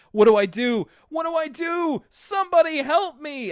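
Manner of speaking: high-pitched panicked cry for help; D W panicking. Retold or imitated. Little sister sees her brother has melted, panicking. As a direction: wail it high and loud in mock panic. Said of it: "like, D W panicking".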